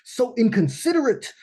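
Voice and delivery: hammed-up grumbling at rude dancers; mock indignation. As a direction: huff at the inconsiderate crowd. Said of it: "I'm, like, protecting myself".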